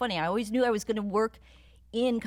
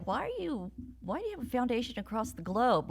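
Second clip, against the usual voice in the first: pitched-up incredulous why; lightly mocked disbelief, higher; imitating puzzled questioners; 6 semitones higher than usual. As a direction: quote the doubters in a higher voice. Quoting people who question her choice.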